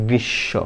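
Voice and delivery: গ্রীষ্ম with a doubled ষ, "grisho". Shown as a demonstration. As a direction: hold the শ in গ্রীষ্ম twice. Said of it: "দুইবার মনে হইছে যে শয়ে শ". (গ্রীষ্ম দ্বিত্ব শ-এ উচ্চারণ)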